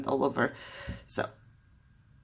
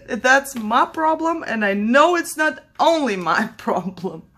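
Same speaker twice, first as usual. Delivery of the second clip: laughing; 6 semitones higher, 3 dB louder than usual. Real, not acted. Laughing at her straw problem.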